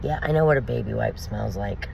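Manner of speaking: quiet, dry sarcasm at the husband; deadpan mockery, voice kept low. Wife mocking her husband's excuse, in quiet sarcasm. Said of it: "I'm like".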